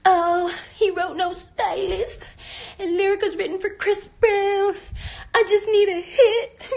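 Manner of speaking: slow, high mock-whine; mocking imitation. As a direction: drag it out like a fake sob story. Mocks rival's livestream complaint.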